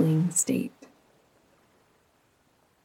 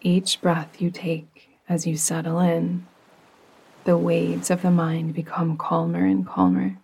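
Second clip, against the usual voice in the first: calm, unhurried meditation voice with long pauses; soothing yoga nidra narration, evenly paced; guided meditation. Acted. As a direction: guide the listener gently down into rest.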